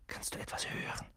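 hushed whisper; boy whispering in the dark passage. Acted. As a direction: breathe the question. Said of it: "flüsterte Ron".

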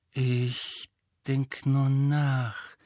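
drawn-out, absent-minded reply; brooding. A narrator's reading. Hermine answers friends while brooding at the window, drawn-out. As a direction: stretch each word out slowly, lost in thought.

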